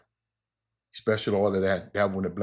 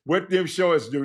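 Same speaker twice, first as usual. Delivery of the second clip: higher, louder mock voice for D Hag; imitating D Hag; 8 semitones higher, 5 dB louder than usual. Host quoting D Hag's question in a higher mock voice. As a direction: pitch it up and hammed-up, a put-on voice. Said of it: "D Hag said".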